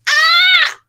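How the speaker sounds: a loud, very high scream of fright; frightened scream. Acted. Farmer seeing the river below, screaming in fright. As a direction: shriek at the river far below. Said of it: "he went".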